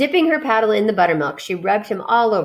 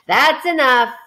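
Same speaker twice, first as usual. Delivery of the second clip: louder, higher excited rat; cried out; 6 semitones higher, 5 dB louder than usual. Acted. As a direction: stop him before you drool. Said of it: "cried Templeton".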